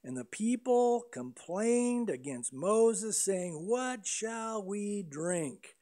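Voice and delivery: a measured, slow reading of the complaint; slow, deliberate reading of "What shall we drink?"; slow scripture reading. Acted. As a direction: read the verse very slowly, laying each word out. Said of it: "don't think they're politely asking".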